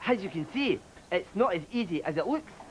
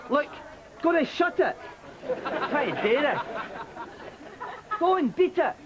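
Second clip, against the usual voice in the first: sudden outburst; 7 semitones higher than usual. Acted. Presenter snaps at crew.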